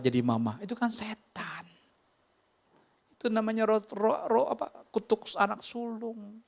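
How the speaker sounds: high, urgent preacher's cry; fiery sermon climax, voice soaring; impassioned preaching. Acted.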